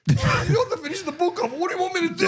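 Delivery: high laughing voice; laughing quote. Retold or imitated. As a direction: quote the mate through laughter. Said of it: "he goes".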